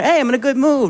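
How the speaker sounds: high, chipper good mood; upbeat man. Retold or imitated. A man showing his good mood, high and chipper. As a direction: bounce up into it. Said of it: "we're like".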